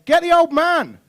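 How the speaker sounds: put-on high, loud kid war cry; girls yelling. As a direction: scream it like a girl charging in a game. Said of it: "twelve-year-old girls going".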